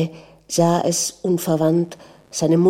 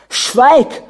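loud, high shouted order; furious command; 7 semitones higher, 7 dB louder than usual. A narrator's reading. Prince orders his wife to be silent, shouting.

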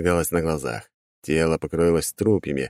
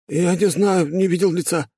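put-on frightened young man; 12 semitones higher than usual. Boy lies under interrogation.

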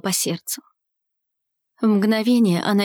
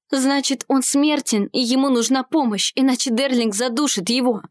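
plain reading voice

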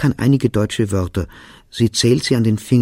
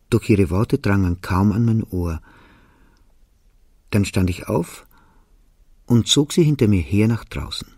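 usual speaking voice

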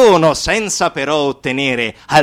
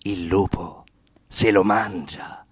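proverbio minaccioso a bassa voce; 7 semitones lower, 5 dB quieter than usual. Acted.

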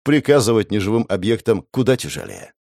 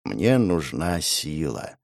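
quiet resolve; 5 semitones lower, 6 dB quieter, much slower than usual. A narrator's reading. Grim inner resolve.

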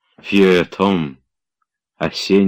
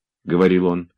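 ordinary delivery, nothing marked

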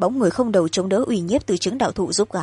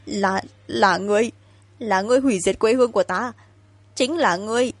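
put-on sobbing angry child; 2 semitones higher than usual. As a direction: choke out the accusation through tears. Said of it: "Giọng con nít nức nở, giận dữ văng vẳng".

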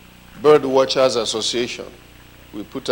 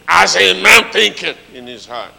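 loud proclaiming quote; preaching emphatically; 4 semitones higher, 10 dB louder than usual. Acted. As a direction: proclaim the verse loudly. Quoting scripture emphatically.

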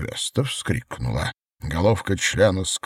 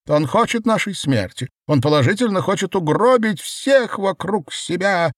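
loud, high-pitched outrage; seething woman; 12 semitones higher, 5 dB louder than usual. A narrator's reading. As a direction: rant in a high, bubbling fury. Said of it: "удалился клокочущий женский голос".

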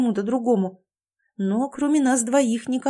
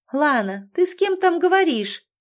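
high-pitched call down to a friend; raised, much higher questioning call; calling from above; 8 semitones higher, 4 dB louder than usual. Acted. Girl upstairs asks Лана who she is talking to, calling down. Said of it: "раздался девичий голос сверху".

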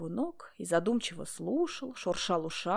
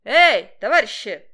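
louder hailing shout; loud call from afar; 3 semitones higher, 12 dB louder than usual. A narrator's reading. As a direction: yell to get their attention. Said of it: "кричал он".

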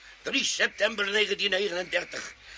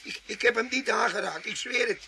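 much higher, loud, desperate pleading; panicked denial; 6 semitones higher, 3 dB louder than usual. Acted. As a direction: beg them to believe him. Tramp panics, accused of murder.

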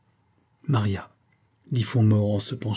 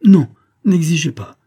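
louder, higher protest; agitated refusal; 7 semitones higher, 9 dB louder than usual. A narrator's reading. Maria begs Font-Mort not to insist, agitated. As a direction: push his demand away forcefully.

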